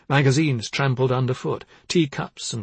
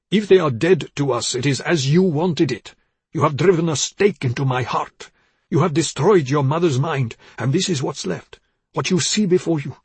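loud, emphatic condemnation; angry, accusing father; 4 semitones higher, 6 dB louder than usual. A narrator's reading. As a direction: build the blame to a forceful dismissal. Father accuses and banishes daughter.

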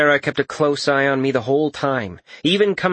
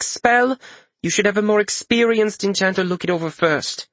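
lightly raised, brisk healer; brisk professional healer, slightly higher; 7 semitones higher than usual. Acted. Mender's voiced dialogue.

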